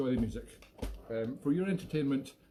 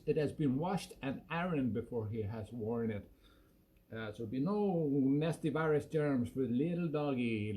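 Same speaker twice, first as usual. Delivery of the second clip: ordinary delivery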